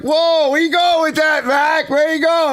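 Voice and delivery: high, loud alarmed shout; freaking-out cops. Retold or imitated. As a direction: imitate cops yelling to stop someone. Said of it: "They're, like, freaking out".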